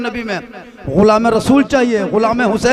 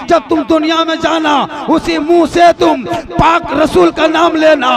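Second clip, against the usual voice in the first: high, loud shouted exhortation; full-force preaching; 6 semitones higher, 6 dB louder than usual. Real, not acted. Preacher warning believers to keep their mouths pure, shouted exhortation. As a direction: hammer each command at the congregation.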